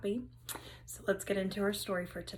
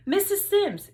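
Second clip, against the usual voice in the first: eager call; 11 semitones higher, 7 dB louder than usual. Acted. Billy eagerly calling teacher.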